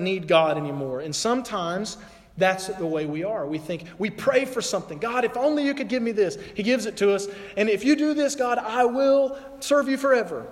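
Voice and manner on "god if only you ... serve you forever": higher, louder bargaining plea to God; pleading prayer. Retold or imitated. Believer bargaining with God for a favour, higher pleading.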